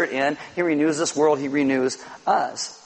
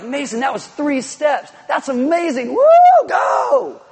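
cheering parent, rising higher into a loud woo; proud parent cheering; 10 semitones higher, 7 dB louder, slower than usual. Pretend. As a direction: cheer on a baby's first steps. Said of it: "do you think they were like".

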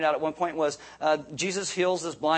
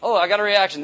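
mock triumph; 3 semitones higher, 10 dB louder than usual. Mock excitement at a reaction.

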